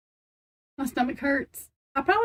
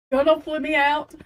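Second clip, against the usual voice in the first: normal talking voice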